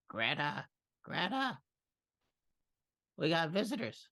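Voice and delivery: high voice calling out to Greta. Acted. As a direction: call for Greta, the voice rising.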